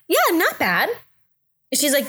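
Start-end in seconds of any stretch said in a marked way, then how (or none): 0.1-0.9 high-pitched breezy cover-up; pretending all is fine, voice way up; fake casual. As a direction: play it down in a high, cheery voice. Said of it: "I don't like people to know when I'm, like, in pain".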